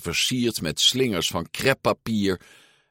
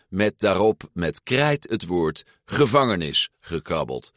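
ordinary delivery, nothing marked

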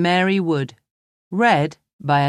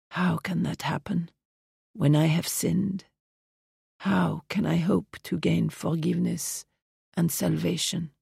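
whispered plea; 2 semitones higher, 6 dB quieter than usual. Acted. Dying mother's whispered plea.

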